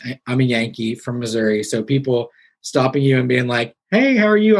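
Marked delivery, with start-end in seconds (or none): 3.9-4.6 cheery stranger greeting. Retold or imitated. Friendly Southern greeting mimicked.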